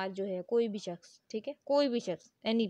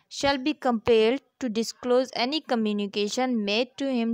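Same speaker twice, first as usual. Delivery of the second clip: slow, deliberate, louder reading of the law text; statute reading; 5 dB louder, much slower than usual. Acted. Teacher reading the law to students, slow formal reading. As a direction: read each clause out slowly, like dictating. Teacher reads article aloud.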